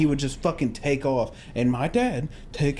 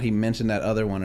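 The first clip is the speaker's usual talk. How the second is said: ordinary talk, nothing marked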